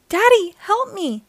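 high, loud panicked cry; crying out for help. A narrator's reading. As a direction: scream for your dad, terrified. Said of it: "he cried".

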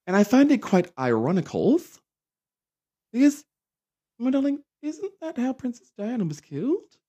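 high, lilting mock-aristocratic tone; high mock-posh "my darling". Mock-posh irony about royals.

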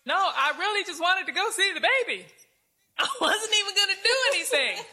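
high, fast, laughing plea as the dragon; laughing dragon whining he just wanted to see the baby; whiny dragon. Acted. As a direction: play the dragon whining it meant no harm. Dragon voiced pleading, laughing.

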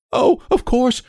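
high-pitched panicky agreement; hysterical. A narrator's reading. Terrified nurse answers the father, hysterically. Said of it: "she cried hysterically".

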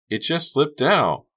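high, slow, pleading excuse; guilty excuse. A narrator's reading. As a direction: plead it out slowly. Guilty friend excusing herself.

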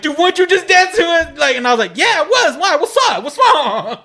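high, loud mock friend voices; incredulous friends. Retelling a club night to friends, high loud mock voices. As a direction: play the confused buddies asking what's up.

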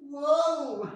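very loud, high whoa; hyped whoa. Real, not acted. Reacting to the rapper's wild line, loud high whoa. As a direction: yell it, totally floored. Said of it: "reacting to a bar".

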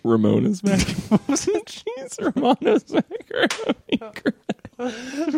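high, slow, laughing repeat; laughing disbelief. Real, not acted. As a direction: stretch it out through laughter. Mocking the slip, laughing.

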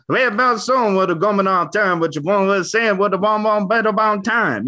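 high, foreign gibberish imitating English; fake English gibberish. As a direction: mimic an Italian singer faking English. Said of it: "American says".

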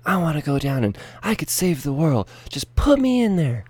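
a keen kid begging to be sent in, voiced lightly up high; eager young Jesus. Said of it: "And Jesus says".

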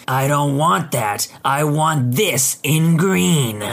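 very slow, each word drawn out. Retold or imitated. Customer glaring and slowly repeating her demand to the clerk. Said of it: "She glares at me and says slowly".

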